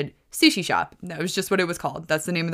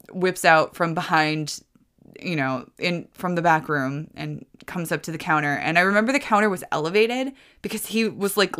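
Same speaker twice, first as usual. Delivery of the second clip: normal talking voice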